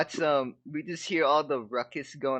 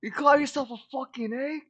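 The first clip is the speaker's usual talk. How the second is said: high, mock British chef roar; Gordon Ramsay impression; 10 semitones higher than usual. Friend mocks Dragoon as a bad egg, mock chef rant. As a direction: sneer it like an angry TV chef. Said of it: "I can't do a Gordon Ramsay".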